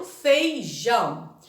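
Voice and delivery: "feijão" slowly split, leaning on JÃO; stress on last syllable. Shown as a demonstration. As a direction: draw out "feijão" as fei, JÃO. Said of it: "Quando a sílaba tônica for a última".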